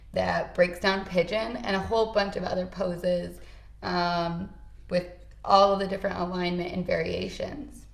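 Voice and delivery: normal talking voice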